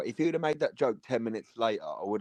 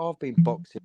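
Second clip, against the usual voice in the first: "I've been boxing." echoed back higher and louder in mockery; mocking echo; 6 semitones higher, 9 dB louder than usual. Throwing the pub guy's boast back at him, mocking. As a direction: parrot his boast to mock him. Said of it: "And I was like".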